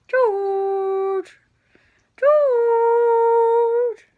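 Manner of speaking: high loud call to a cat. Real, not acted. Owner calling her cat across the room, loud high call. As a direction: sing out the cat's name, high and loud.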